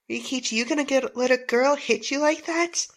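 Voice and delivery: normal talking voice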